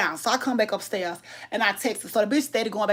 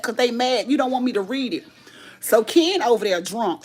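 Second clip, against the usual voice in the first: ordinary talk, nothing marked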